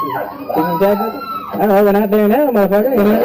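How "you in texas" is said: mock incredulous. Mimicking surprised Texan question.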